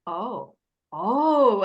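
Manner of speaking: acted-out moment of it clicking, swelling on the second oh; drawn-out, swooping "oh" of someone finally getting it; dawning realisation. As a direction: imitate his dawning "oh", the second one bigger. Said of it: "his face is like".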